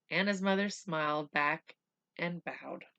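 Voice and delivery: usual speaking voice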